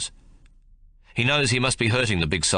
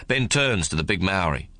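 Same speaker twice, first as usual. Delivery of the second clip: usual speaking voice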